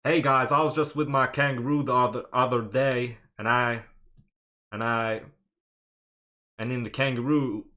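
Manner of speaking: loud, put-on Australian accent. Shown as a demonstration. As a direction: play up a broad Australian accent, loudly. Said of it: "Uh, Australian accent".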